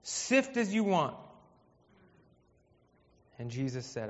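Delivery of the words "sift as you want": eager Peter. Acted. Peter answering Jesus with a pledge of loyalty, boldly. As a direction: declare it boldly, drawing out the last words. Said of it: "Peter said to him".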